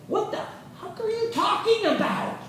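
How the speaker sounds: high-pitched indignant outburst, acting out a hurt partner; mock angry outburst. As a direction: screech it as the betrayed wife. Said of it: "you're gonna be angry at him and say".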